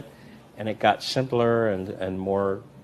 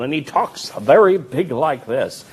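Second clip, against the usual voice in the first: slow, much louder, higher booming showman; grandiose magician; 6 semitones higher, 10 dB louder, slower than usual. Shown as a demonstration. Demonstrating a grandiose magician's voice, loud and slow. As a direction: boom it out like a grand stage magician. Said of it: "he's like Harry Blackstone".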